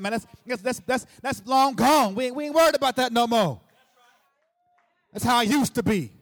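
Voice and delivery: loud, high-pitched preaching cry, far above it all; full force preaching. Acted. Preacher to congregation, loud triumphant preaching. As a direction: shout triumph over the past to the church.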